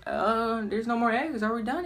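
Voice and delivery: mock-puzzled child asking; puzzled kids.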